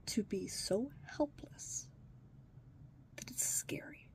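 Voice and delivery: soft, slow whisper; whispered despair. Real, not acted. Telling the listener how helpless they are, whispered. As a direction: let the words fall to a breath. Despairing aside about the kids.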